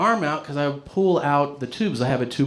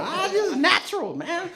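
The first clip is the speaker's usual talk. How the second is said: high, smug mock-casual; cocky brag. Drunk him answering the bar, high and smug. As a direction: shrug it off like a big shot.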